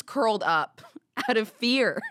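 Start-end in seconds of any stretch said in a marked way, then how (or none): none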